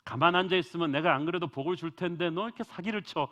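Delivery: mock-berate the cheat as God did not; pretend angry God. Imagined God berating cheating Jacob, a loud mock scolding. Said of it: "이렇게 하신 것이 아니고".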